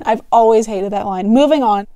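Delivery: plain reading voice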